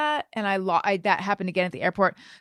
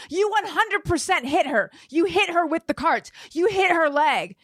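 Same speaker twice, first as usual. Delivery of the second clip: high, relentless accusation; insistent yelling; 8 semitones higher than usual. Retold or imitated. To the cart worker, high insistent accusing. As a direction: hammer the point home. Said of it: "yelling like this".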